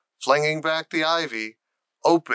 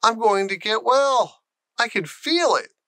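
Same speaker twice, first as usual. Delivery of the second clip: much higher, elated; joyful; 8 semitones higher than usual. Acted. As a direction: announce it with wonder. Joyful outburst while walking.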